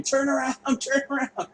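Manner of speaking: high panicked yell of turn around, laughing through it; mock panicked mother yelling high; mom screaming. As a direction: shout it like a frantic mom in the car. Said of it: "My mom was screaming".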